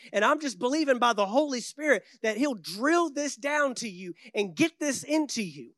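normal talking voice

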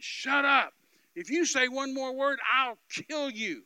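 high, loud, slow death threat; retold gunman screaming at the preacher, high and loud. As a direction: scream it like a gunman ready to shoot. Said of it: "he's screaming".

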